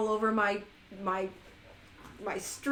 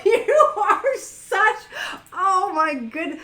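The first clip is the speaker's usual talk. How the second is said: high, slow laughing disbelief; laughing at a chat guest; 8 semitones higher, 9 dB louder, much slower than usual. Real, not acted. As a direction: crack up at him. Cracking up at Leon's comment.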